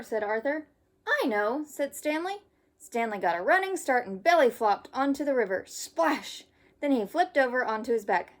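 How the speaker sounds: lively storybook read-aloud; reading to children. Acted. Teacher reading a camping story to kids. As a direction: read it brightly for young listeners. Reads picture book to children.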